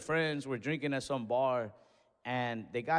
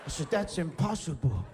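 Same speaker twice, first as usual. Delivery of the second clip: mock anime gasp; 2 semitones higher than usual. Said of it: "that anime gas".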